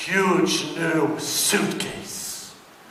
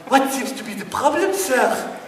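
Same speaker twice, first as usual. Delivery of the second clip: desk lady; 7 semitones higher than usual. Retold or imitated. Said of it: "she goes like".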